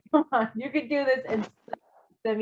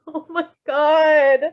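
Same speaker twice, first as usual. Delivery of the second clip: laughing; 5 semitones higher, 4 dB louder, much slower than usual. Real, not acted. Still laughing at the scene.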